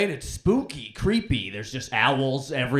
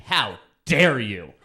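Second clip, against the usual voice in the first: mock outrage; 3 semitones lower, 5 dB louder, slower than usual.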